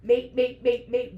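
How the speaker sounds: "mate" hammered loudly each time; loud, insistent beat on each "mate"; frantic repetition. Acted. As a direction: punch every "mate" like a racing thought. Racing thoughts voiced, word hammered.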